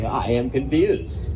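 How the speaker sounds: slow dopey I am confused; slow mock audience. Comic imitating a baffled audience member, slow and dopey. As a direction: drag out I am confused like a puzzled listener. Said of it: "just in case you guys are like".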